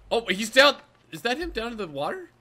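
loud, high and eager; excited. Real, not acted. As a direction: call it out excitedly. Spotting monster after chaos.

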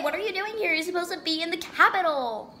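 ordinary talk

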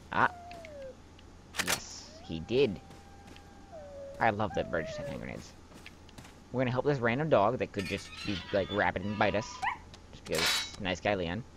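usual speaking voice

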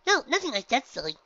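very high, loud bubbly pony voice; Pinky, exclaiming. Acted. Pinky introduces herself to the gym leader, very high and bubbly. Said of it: "Pinky said with a smile".

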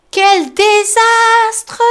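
very slow, high, loud wail; Tom moaning. Acted. Tom lamenting the mess, slow high wail. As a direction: moan over the ruined garden. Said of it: "gémit Tom".

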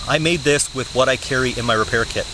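ordinary talk, nothing marked

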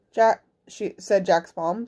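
plain reading voice

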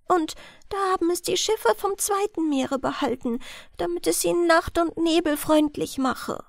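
put-on lightly sad girl explaining. A narrator's reading. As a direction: speak small and hushed like the girl. Girl's voice, misattributed.

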